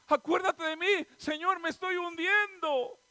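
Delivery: grito agudo y fuerte de auxilio; clamor con miedo. Acted. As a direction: clama como quien se está ahogando. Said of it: "empezó a ver el miedo y sintió". (súplica desesperada, a gritos)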